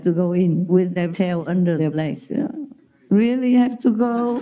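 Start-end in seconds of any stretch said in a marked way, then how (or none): 3.1-4.3 higher, plaintive little dog asking; pleading dog voice. Retold or imitated. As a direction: voice the dog begging not to go. Dog's pleading voice, retold.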